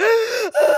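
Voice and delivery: high, crying gasp; imitated sobbing gasp. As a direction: gasp like someone bawling. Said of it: "he's like".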